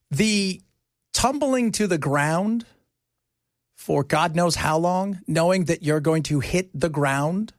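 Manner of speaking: drawn-out, slow; slow down, let it sink in; slow and grave. Real, not acted. Imagining the fall.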